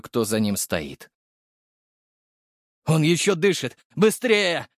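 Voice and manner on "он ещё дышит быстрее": high, urgent shouting; straining yell. A narrator's reading. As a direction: strain your voice calling for haste. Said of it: "надрывался над ухом служивый". (надрывный крик, торопя)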